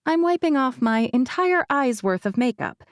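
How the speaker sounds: plain reading voice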